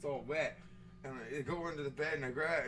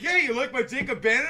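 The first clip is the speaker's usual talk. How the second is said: higher, louder imitation of a friend; oblivious friend; 8 semitones higher, 11 dB louder than usual. Friend showing off his print, mock oblivious. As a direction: lightly mimic a pleased, clueless pal.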